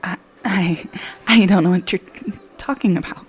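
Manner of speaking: stammering, thrown off; nervous stutter. Acted. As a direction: stammer out the denial. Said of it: "her stutter slipping through".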